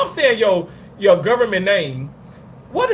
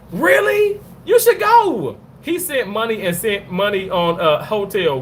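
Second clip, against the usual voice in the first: ordinary delivery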